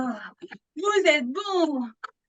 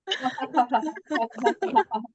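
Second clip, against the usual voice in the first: long laugh; 4 semitones lower than usual. Shown as a demonstration. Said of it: "laughter yoga demo".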